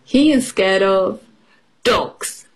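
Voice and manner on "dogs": ordinary delivery, nothing marked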